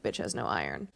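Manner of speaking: low flat deadpan verdict. Retold or imitated. Blood test personified.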